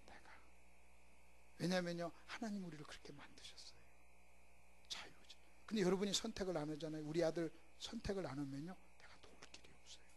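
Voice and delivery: soft whispered preaching; whispering preacher. Acted. Preacher whispering to the congregation, hushed. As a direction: lean in and whisper the point.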